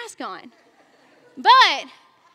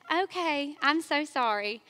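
soft, mock-meek apology; 6 dB quieter than usual. The godly woman pretending to apologize meekly. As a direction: pretend to give in sweetly. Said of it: "Y'all know I didn't do that".

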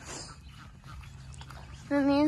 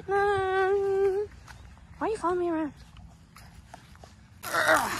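usual speaking voice

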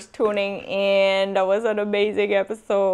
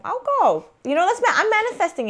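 excited; 11 semitones higher, much faster than usual. Real, not acted. Excited manifesting.

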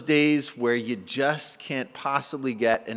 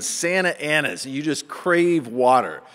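ordinary talk

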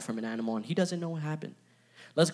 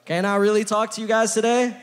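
higher and louder call to the crowd; rallying call, louder; 7 semitones higher, 6 dB louder than usual. Real, not acted. Preacher demanding a louder answer from the congregation, higher and louder. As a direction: push them to answer. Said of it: "I don't sound convinced".

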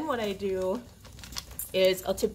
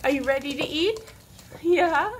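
high excited pet talk; 7 semitones higher, 3 dB louder than usual. Real, not acted. Excited dog feeding time.

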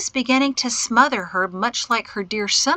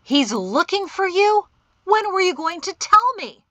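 high, loud, livid accusation; angry demand; 10 semitones higher, 6 dB louder than usual. A narrator's reading. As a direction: demand answers in raised, angry voice. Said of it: "were dark with anger".

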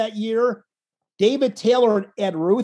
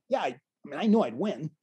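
low, much softer, unbothered; laid-back and confident; 6 semitones lower, 7 dB quieter than usual. Retold or imitated. Retelling the calm winner's answer, low and laid-back. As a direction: mimic his laid-back calm, dropping low and soft. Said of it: "his laid back demeanor of like".

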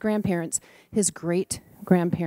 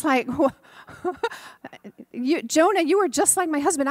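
higher and louder, laughing in disbelief; exasperated outburst; 8 semitones higher, 4 dB louder than usual. Real, not acted. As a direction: burst out in amused exasperation.

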